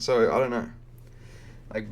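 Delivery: usual speaking voice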